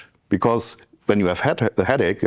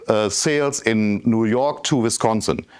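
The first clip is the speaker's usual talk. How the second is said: ordinary talk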